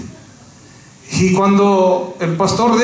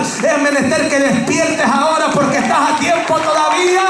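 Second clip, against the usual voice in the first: preaching at full force; 7 semitones higher than usual. Acted. Pastor to his church, preaching at full force. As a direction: lift the congregation with a booming shout.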